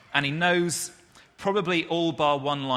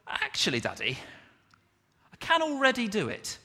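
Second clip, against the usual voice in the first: put-on child's voice; 9 semitones higher than usual. Child voiced in sermon story.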